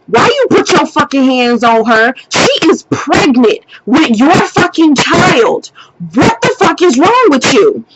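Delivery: indignant telling-off, pitch climbing as it goes; going off. Retold or imitated. Woman confronting him over his pregnant girlfriend, going off angrily. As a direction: lay into him, stretching out the accusation. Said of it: "I'm going off on him".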